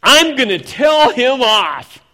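loud, high, drawn-out threat; angry outburst. Acted. Believer fuming at someone, loud angry outburst. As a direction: burst out loudly, pitched high.

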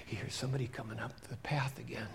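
fast hushed whisper. A narrator's reading. Preacher telling kids someone approaches, whispering. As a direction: whisper the footsteps nearing. Suspense narration.

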